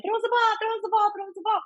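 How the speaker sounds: put-on high-pitched pestering kids' call; mock kid yelling. Mom imitating little leaguers begging pitchers for balls, mock yelling. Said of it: "yelling all the time".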